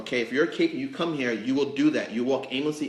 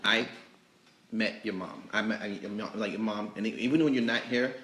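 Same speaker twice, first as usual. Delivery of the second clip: normal talking voice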